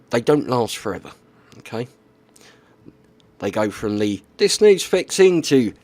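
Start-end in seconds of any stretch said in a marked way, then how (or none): 4.4-5.4 high, louder quoted voice; quoted client voice. Retold or imitated. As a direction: pitch up for the client's words. Quoting the client phase.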